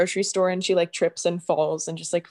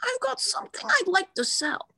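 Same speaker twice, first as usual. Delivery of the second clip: Marge Simpson impression; 12 semitones higher than usual. Friend taking a turn at the Marge line. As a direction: push the voice way up for Marge. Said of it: "now is Marge Simpson".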